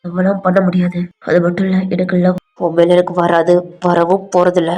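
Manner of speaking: low, firm rejection; restrained anger. Acted. V rejecting Alia's love, restrained low anger. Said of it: "நீ இப்படி கோபமா பேசுறா".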